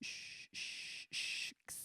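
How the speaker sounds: soft hissed cymbal sounds, cut off at the end; imitated cymbal crashes. Shown as a demonstration. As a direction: hiss each crash, then choke it.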